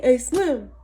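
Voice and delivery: calling out to a friend far off; retold yell. Neighbor's lawn guy hailing her across the street, a loud call. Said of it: "He yells at me from across the street".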